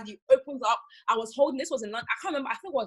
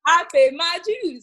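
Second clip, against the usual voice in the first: louder, higher, slow declaration; repeating her point to the host, louder and higher; emphatic; 7 semitones higher, 11 dB louder, slower than usual. Real, not acted. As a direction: proclaim it triumphantly. Repeats louder after laughing.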